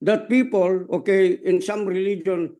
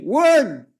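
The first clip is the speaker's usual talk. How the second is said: loud call of Word; shouted cue word; called response; 3 semitones higher, 6 dB louder than usual. Shown as a demonstration. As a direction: call out the word for the crowd to echo. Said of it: "Say it with me".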